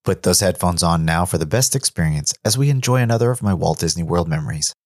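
normal talking voice

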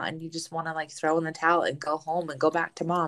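ordinary talk, nothing marked

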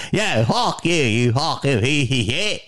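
hammed-up Philly Delco cursing; mock-hostile Delco heckler; Delco accent. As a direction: hurl abuse in a thick Delco accent. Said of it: "That's how people talk".

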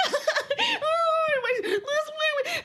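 very high wailing nonsense; weird panicked noises. Retold or imitated. Mother flailing before onlookers, high weird panicked noises. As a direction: babble high and helpless, half laughing. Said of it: "making weird noises".

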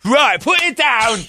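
high, drawn-out British rocker warning. Acted. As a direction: raise the pitch and scold in alarm.

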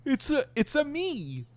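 hammed-up Italian Mario voice; Mario catchphrase. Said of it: "He's like".

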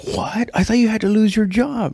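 surprised Joe Rogan. Retold or imitated. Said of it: "And Joe Rogan was like".

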